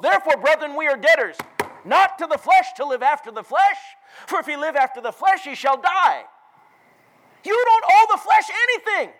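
high, loud pulpit shout; full-force preaching. Real, not acted. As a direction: thunder it out to the congregation.

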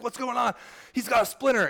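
high, rising disbelief at the fuss; can't believe it's just a splinter; surprised high. Retold or imitated. Wife bursting in on the chaos, high and incredulous. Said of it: "She's like".

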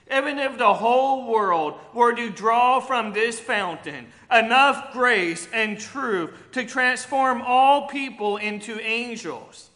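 preaching at full force. Acted. Pastor preaching grace to the congregation, loud and fervent. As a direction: proclaim it to the whole church.

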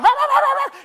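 loud, high imitated barking; frantic dog barking. Dogs freaking out at falling runners, imitated barking. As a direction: bark like dogs going berserk. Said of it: "the dogs are freaking out now".

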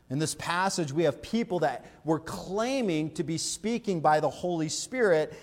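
usual speaking voice

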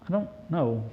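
low, soft, sheepish shrug of a reply. Acted. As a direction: mumble it like you have no idea. Widow asked about the oil.

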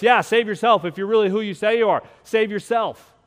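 loud, high mocking taunt; jeering thief. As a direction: sneer it like a dying thief. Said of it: "One of the thieves even joins in".

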